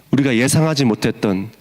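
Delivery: normal talking voice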